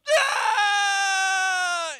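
very high, very loud crying shout; imitated wail. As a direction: let out a child's howl of tears. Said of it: "She goes".

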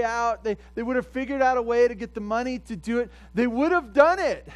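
high, loud pulpit preaching; rising revival preacher call; full-force preaching. Acted. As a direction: drive it home like a fiery preacher.

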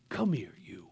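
whispered, soft and loving; tender whisper. Retold or imitated. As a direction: pull your son close in a whisper. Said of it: "And then he says".

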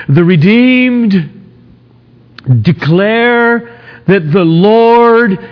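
slow, high, ringing declamation; preaching. Acted. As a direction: proclaim it slowly, loud. Declaims the psalmist's words.

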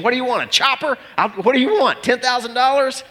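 high, desperate bargaining; exasperated dad bargaining as if with a kidnapper; mock hostage negotiation. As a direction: plead like a frantic negotiator. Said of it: "I'm like having a hostage negotiation".

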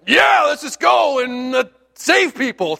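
high, shouted mock preacher fire; a friend's booming sermon voice, imitated; imitating Hank preaching. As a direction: ham up a fired-up street preacher. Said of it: "it'll sound like a sermon".

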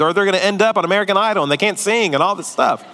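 usual speaking voice

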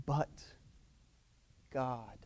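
hushed, low British preacher, long pause between words; British accent. Retold or imitated. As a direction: imitate a British preacher, slow and grave. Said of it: "in his British accent".

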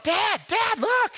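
put-on high child's voice calling dad; kid calling out. Son yelling to his dad from the river. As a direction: shout it up high like an excited little boy.

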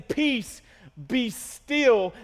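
commanding. Acted. Preacher repeating Jesus's words to the storm, forceful command. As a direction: punch out each phrase with a pause between. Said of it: "after Jesus is saying".

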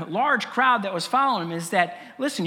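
normal talking voice

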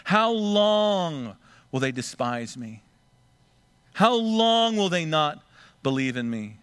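slow, drawn-out reading of God's question; slow to anger. Acted. God patiently asking how long his people will doubt, slow. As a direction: let each how long hang, patient.

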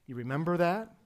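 usual speaking voice

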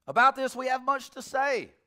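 reading the verse out high and loud; booming verse reading; loud scripture read. Acted. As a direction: proclaim the verse with force.

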